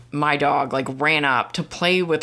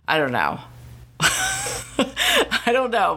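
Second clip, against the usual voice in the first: high real laugh spilling into the words; giggling through a shrug; 8 semitones higher than usual. Real, not acted. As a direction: laugh it off, half exasperated. Laughs off frustration.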